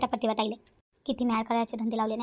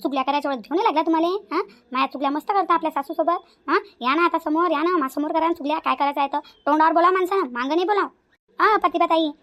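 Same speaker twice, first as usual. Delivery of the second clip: loud and higher, calling her to come out and face me; taunting call-out; 4 semitones higher, 5 dB louder than usual. Acted. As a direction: hurl the challenges across the house, mocking. Rohini calling and taunting her rival. (जोरात टोमणे मारत हाक)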